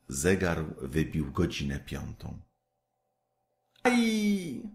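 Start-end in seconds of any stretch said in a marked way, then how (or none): 3.8-4.6 a high, soft little "Aj!"; a startled yelp; sudden cry. A narrator's reading. Kaj cries out as a splinter strikes, a high yelp. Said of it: "zawołał nagle Kaj".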